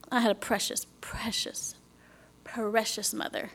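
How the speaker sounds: whispered, grieving and hushed; tender whisper. Real, not acted. Remembering her late mother, in a whisper. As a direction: breathe the words out in grief.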